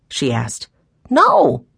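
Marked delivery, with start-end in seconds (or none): 1.1-1.7 put-on high, loud child's denial; little boy protesting; emphatic young boy. A narrator's reading. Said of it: "answered Benny".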